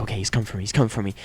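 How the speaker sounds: hushed terror; scared whisper-like. Retold or imitated. Player hiding from the ghost, a low hushed murmur. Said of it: "and I'm like".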